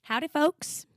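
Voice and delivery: hint of a Southern drawl, rising; a playful Southern howdy; mock Southern accent. Co-host greeting listeners in a light mock Southern accent. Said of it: "I'm not gonna talk in that accent".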